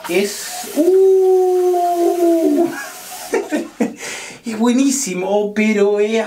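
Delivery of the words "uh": shocked laugh. Real, not acted. Reacting to cold water.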